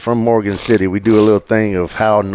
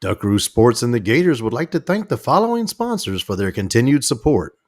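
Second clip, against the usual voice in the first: announcer reading sponsors; PA announcer thanking sponsors. Acted. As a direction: read the sponsor list like a PA ad. Sponsor read.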